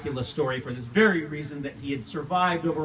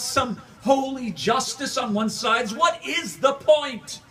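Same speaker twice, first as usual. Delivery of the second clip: indignant outburst; 11 semitones higher, 5 dB louder, slower than usual. Acted. Rising outrage in the monologue.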